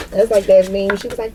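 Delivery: ordinary delivery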